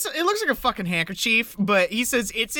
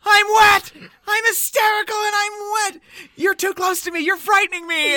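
much higher, louder panicked shriek; hammed-up panic, higher and louder; 10 semitones higher, 4 dB louder than usual. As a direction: squeal it like a frightened man-child. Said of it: "and he goes".

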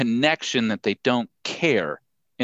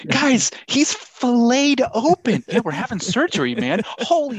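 panicking surgeon; 13 semitones higher, 4 dB louder than usual. Retold or imitated. Surgeon mocked as panicking at an open patient, high and horrified. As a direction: wail it like a surgeon losing it.

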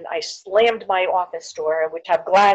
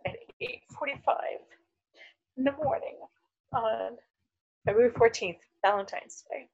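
hushed build-up; 4 semitones higher, 9 dB quieter than usual. Real, not acted. Dramatic build to bad news.

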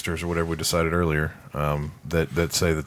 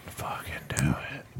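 hushed whisper, lower; 5 semitones lower, 8 dB quieter than usual. Real, not acted. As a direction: urge it under your breath. Egging on in a whisper.

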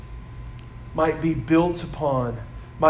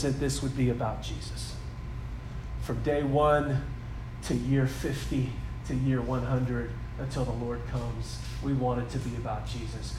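soft heartfelt appeal; 7 semitones lower, 8 dB quieter than usual. Acted. Pastor tells the new church its heart's desire, softly. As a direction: lower and softer, intimate preaching.

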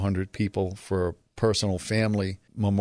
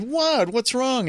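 higher, startled innocent what; surprised what; slower than usual. Retold or imitated. He retells being smacked on the date, higher baffled surprise. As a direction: act baffled after the smack. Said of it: "and I said".

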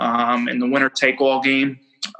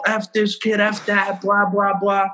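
higher-pitched imitation of a coach ranting; mock coach rant; 5 semitones higher than usual. Rival coach cursing the pitcher to his team, retold as a mock rant. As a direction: rattle off the censored rant, pitched up.

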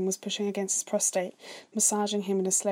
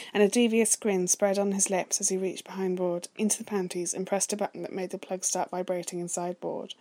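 ordinary delivery

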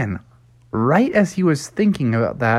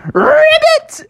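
high, loud croaking ribbit; frog ribbit; 7 dB louder than usual. Acted. Froggy jumps from his seat with a high, loud ribbit.

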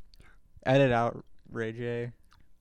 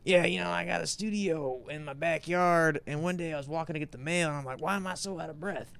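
a hint of a tired [name]; imitating [name]; 4 semitones higher than usual. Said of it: "just imagining his voice where he's like".

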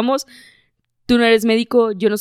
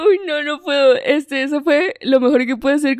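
laughing; 5 semitones higher than usual. Real, not acted. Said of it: "laughing after skit".

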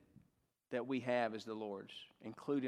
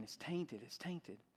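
murmured under breath, much softer; quiet repeating; 9 dB quieter, slower than usual. Retold or imitated. A little boy in church, worried, murmuring softly.

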